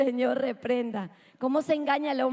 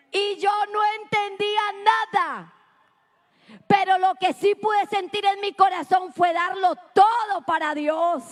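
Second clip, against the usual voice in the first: full-force preaching; 5 semitones higher, 4 dB louder than usual. Real, not acted. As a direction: drive the truth home to the congregation, stretching key words. Preaches to congregation. (prédica enérgica a plena voz)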